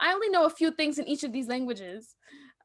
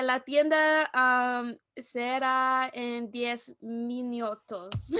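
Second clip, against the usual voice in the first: slow, careful broken Spanish; unsure learner's Spanish; halting Spanish; 4 dB louder, much slower than usual. Retold or imitated. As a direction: sound out each word haltingly. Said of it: "I said".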